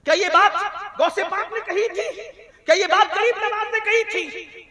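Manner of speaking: high, insistent questioning; forceful rhetorical challenge. Real, not acted. Preacher disputing promises of forgiveness, high challenge. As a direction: fling each question up high.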